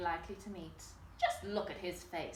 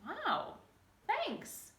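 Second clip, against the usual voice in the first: sniffing; 11 semitones higher than usual. Acted. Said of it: "sniffed the little Grouch".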